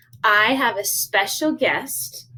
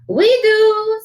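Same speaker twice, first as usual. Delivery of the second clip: high, loud, drawn-out answer; chorusing doggies; 12 semitones higher, 7 dB louder than usual. Acted. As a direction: pipe up eagerly like little dogs. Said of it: "said the doggies".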